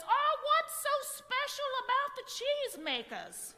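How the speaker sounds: high, shrill, fussy woman; Missus Gregory. Retold or imitated. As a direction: squeak it like a fussy posh lady. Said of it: "Missus Gregory says".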